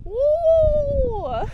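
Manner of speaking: high, loud cheer of joy; thrilled trail whoop; excited whoop. Real, not acted. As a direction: let out a delighted woo. Cheering at the view.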